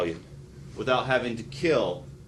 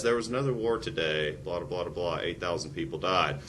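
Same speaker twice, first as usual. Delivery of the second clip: flat, quieter mock news drone; bored newsreader; 5 semitones lower, 5 dB quieter than usual. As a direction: read it like dull routine news. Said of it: "no one ever says".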